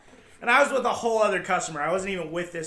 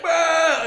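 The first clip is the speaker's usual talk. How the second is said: mock yell; 11 semitones higher, 10 dB louder than usual. Customer going off.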